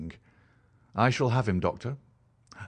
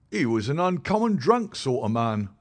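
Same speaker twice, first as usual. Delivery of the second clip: higher, louder common London constable; a plain-spoken beat policeman telling his tale, pitched up; constable Rance; 5 semitones higher, 4 dB louder than usual. Acted. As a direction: policeman recounting what he found, higher and louder. Irritated Rance.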